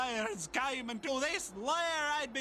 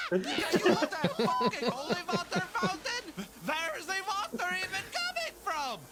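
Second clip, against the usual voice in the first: furious rant; 9 semitones higher than usual. Acted. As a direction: rage at the servant sarcastically. Vampire burned by holy water.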